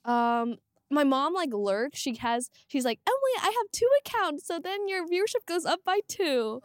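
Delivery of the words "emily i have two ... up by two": mom voice. Retold or imitated.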